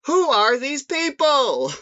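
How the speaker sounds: high, slow, loud Seinfeld bit; drawn-out mock Seinfeld question; Seinfeld impression. Guest joins the comedy bit doing Seinfeld. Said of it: "That was my best Seinfeld".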